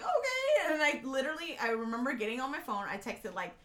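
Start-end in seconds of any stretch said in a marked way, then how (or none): 0.0-0.7 high, loud frightened okay; scared squeak. Retold or imitated.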